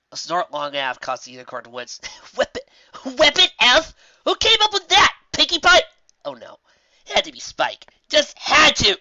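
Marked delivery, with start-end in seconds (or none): 2.4-9.0 high, loud scoffing pony voice; mocking Dash. Dash laughs at the call sign Weapon F, high and loud. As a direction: scoff at the silly name.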